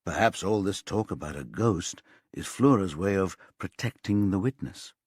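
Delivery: plain reading voice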